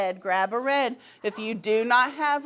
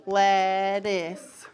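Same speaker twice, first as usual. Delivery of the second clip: "Lettuce" drawn out in two slow, lower syllables; chopped into syllables; 4 semitones lower than usual. Shown as a demonstration. Teacher leading the class in chopping syllables, slow and measured. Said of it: "we're gonna chop the syllables".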